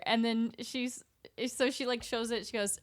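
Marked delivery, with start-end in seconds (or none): none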